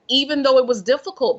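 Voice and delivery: ordinary delivery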